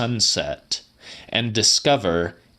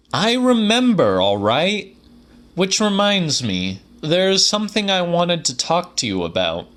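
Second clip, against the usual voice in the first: higher character voice with growing enthusiasm; Quisser, eager; 6 semitones higher than usual. A narrator's reading. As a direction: pitch up and lean in, suddenly eager. Narrator voices Quisser in dialogue.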